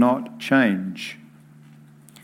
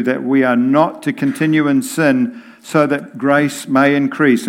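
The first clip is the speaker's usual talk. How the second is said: normal talking voice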